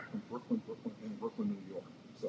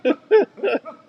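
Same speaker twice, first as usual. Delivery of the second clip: laughing while talking; words through laughter; 7 semitones higher, 15 dB louder, slower than usual. Real, not acted. Laughing after teasing joke.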